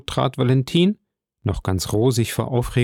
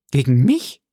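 mildly astonished question, rising at the end; a touch of startled surprise; 3 dB louder than usual. A narrator's reading. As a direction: ask it in disbelief. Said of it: "versetzte Valentine erstaunt".

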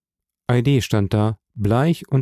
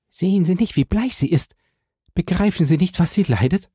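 high, urgent questions; distressed, high-pitched appeal; pleading young woman; 8 semitones higher, faster than usual. A narrator's reading.

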